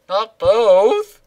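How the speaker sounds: high, loud cartoon voice; squeaky character voice. Reading the game's silly dialogue.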